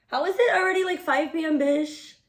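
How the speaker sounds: high, loud disbelief at the time; loud, high-pitched shock, half laughing; shocked outburst. Real, not acted. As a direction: blurt it out, stunned it's already evening. Shocked at the late hour.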